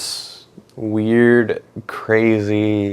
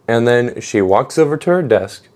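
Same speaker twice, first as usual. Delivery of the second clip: ordinary talk, nothing marked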